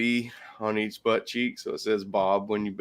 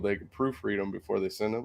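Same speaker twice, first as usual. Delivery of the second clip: ordinary talk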